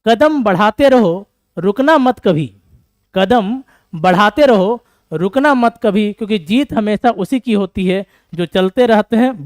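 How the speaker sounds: loud, slow declaiming to spur students on; motivational recital. Shown as a demonstration. Teacher urges students not to quit, declaiming loud and slow. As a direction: proclaim each line slowly and loudly, like a rallying poem. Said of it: "ध्यान सुनिएगा".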